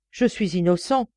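ordinary delivery

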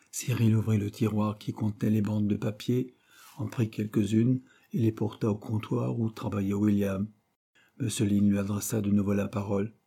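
plain reading voice

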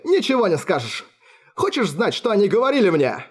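high, mocking madman taunting his father; mad sneer. A narrator's reading. Alfred taunting his father about the guards, high and mocking. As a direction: sneer it out with a crazed grin. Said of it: "полная сумасшествия улыбка".

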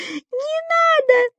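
very high, slow terrified squeal; frightened squeak. A narrator's reading. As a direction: squeak it out in real fear. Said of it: "пискнул Субастик".

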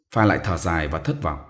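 ordinary delivery

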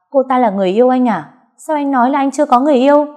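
fast, high, loud accusing; angry accusation. Acted. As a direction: fire the questions fast. Girlfriend confronts him.

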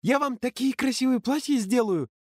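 put-on high eager girlish voice; squeaky girl. Ксюша promising dresses, high squeaky voice. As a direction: chirp it out delighted.

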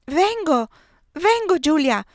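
loud and high shout across the garden; calling back. A narrator's reading. Eugenia calling back to her sister, loud. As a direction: shout back that you're coming. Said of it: "le gridai".

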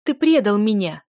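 loud, anguished reproach; betrayed accusation. A narrator's reading. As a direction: fling it at him in hurt anger. Heroine accuses her father.

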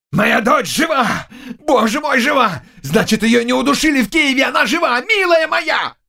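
put-on high-pitched, frantic old man's cries; crazed joyful shouting. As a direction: cry out as if mad with relief. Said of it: "начал кричать, как помешанный". (кричит от радости, как помешанный)

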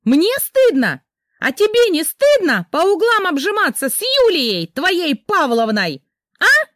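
high, loud furious accusations; wife accusing husband of cheating, screaming; jealous screaming. Acted. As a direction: shriek the accusations at him.

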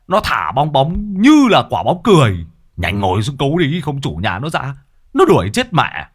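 Long excitedly bragging to the owner behind him; excited boasting. Acted. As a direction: brag loudly about the big fish.

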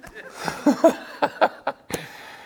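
a high, airy real laugh at the punchline; real breathy laugh. Real, not acted. Pastor laughing at a churchgoer's excuse, high airy laugh. Laughs at his own story.

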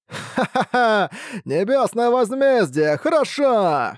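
high cackling demon laughter; mocking laugh. A narrator's reading. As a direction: laugh in the face of heaven. Demon elder mocks heavenly punishment. (зловещий хохот старика)

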